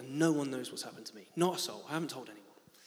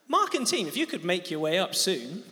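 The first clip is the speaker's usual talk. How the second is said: calling out; 2 semitones higher, 7 dB louder than usual. Real, not acted. Calling worship team up.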